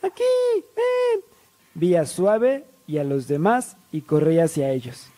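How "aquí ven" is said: very high mock yell; imitated friend calling. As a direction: call someone over in a squeaky high voice. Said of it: "Era Cerecita gritándome".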